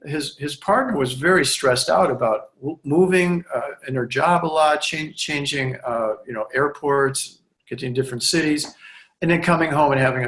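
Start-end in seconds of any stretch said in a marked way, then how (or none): none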